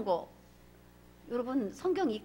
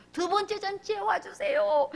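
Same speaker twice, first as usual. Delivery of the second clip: higher, slow, crying plea; mild mock weeping plea; tearful plea; 7 semitones higher than usual. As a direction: beg through tears. Esther's plea acted.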